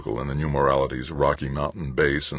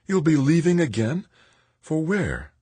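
high-pitched mother's anxious questions; dismayed mother; 12 semitones higher than usual. Acted. Mother hears her son will leave, dismayed. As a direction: ask high and worried.

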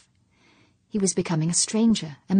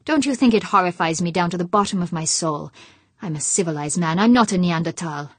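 loud, bitter outburst, louder throughout; snapping angrily; 6 dB louder than usual. Acted. Husband snapping at his worried wife, loud and angry. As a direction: bite off each word in fury. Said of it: "he snapped".

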